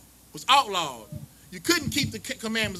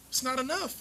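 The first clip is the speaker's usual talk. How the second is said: normal talking voice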